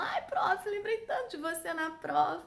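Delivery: high, loud gushing student voice; excited student. Retold or imitated. Student telling the teacher about the exam, high and gushing. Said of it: "um aluno meu falou".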